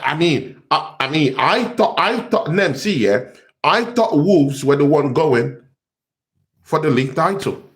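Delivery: ordinary delivery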